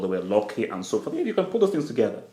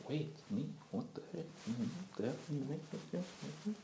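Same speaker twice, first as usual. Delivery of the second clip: very soft, halting disbelief; barely audible, as if taken aback; stunned hush; 20 dB quieter, faster than usual. Acted. Striker reacting to the fan's praise, stunned and hushed.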